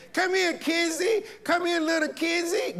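put-on calling a child. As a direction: sing it out like a relative calling a little girl. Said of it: "they probably call Kamisia Kizzy".

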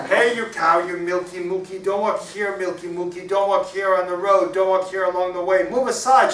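loud, high scolding chant; shouting. Acted. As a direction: holler at the cow to clear the way. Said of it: "shouted Peter".

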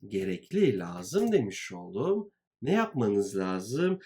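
usual speaking voice

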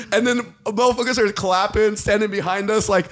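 ordinary talk

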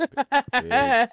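high, loud, slow hype; hyped shout. Acted. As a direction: belt it out, dragged slow. Repeats announcement hyped.

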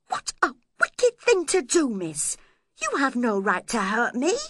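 high, wronged protest; hurt, scandalised accusation; indignant outcry. A narrator's reading. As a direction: complain so a bystander hears. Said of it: "I screamed, to show Edgar".

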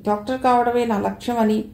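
ordinary delivery, nothing marked

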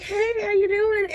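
high-pitched, breezy coworker hello; cheery greeting. Retold or imitated. Everybody at work asking after her, imitated chirpy greeting.

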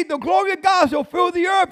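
high, loud shouted proclamation; angel proclaiming. Acted. Angel declaring glory to Isaiah, loud high shout. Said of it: "the angel begins to say".

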